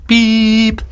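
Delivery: long high steady tone; one sustained high beep; imitated answerphone beep. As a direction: mimic the machine's end-of-message beep. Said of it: "was beeping away on the answer machine".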